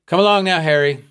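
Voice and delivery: called out loud and high across the snow; calling out. A narrator's reading. Superintendent calling Harry on through the snow, loud call. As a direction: call to him over the storm. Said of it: "Mister Maynard called out".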